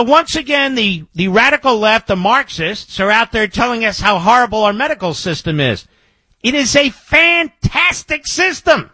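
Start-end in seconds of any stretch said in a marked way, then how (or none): 6.4-8.9 high, slow, emphatic rebuttal; real heated outburst, pitched up high; indignant insistence. Real, not acted. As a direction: insist on it hotly, word by word. Host indignantly rebuts critics.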